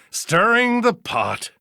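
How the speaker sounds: high-pitched, drawn-out complaint; disgruntled grumbling. Acted. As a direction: stretch it out with high-pitched disapproval. Judge grumbles about protesters.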